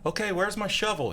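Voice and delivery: put-on high little boy asking for his shovel; eager kid. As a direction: sound like a keen seven-year-old. Son voiced in snake story.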